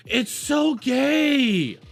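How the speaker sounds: very slow, high, drawn-out yell; mocking shout. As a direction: stretch it out in a mocking wail. Mocking outburst.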